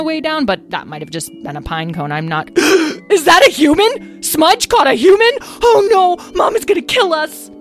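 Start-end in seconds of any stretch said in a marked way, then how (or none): 2.6-7.5 high, loud horrified outburst; gasp, then wail in alarm; shocked panic. A narrator's reading. Lyle spots the human.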